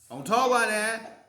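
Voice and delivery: put-on a mother shutting down her kids' name-calling; scolding mama bear. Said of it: "What's Mama Bear gonna do?".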